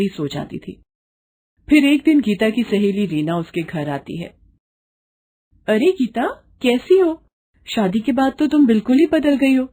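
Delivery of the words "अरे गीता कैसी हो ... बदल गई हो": high, bright-eyed friend's greeting; a visiting girlfriend, lightly voiced and higher; cheerful friend. Friend Reena visiting the bride, lightly voiced higher friend.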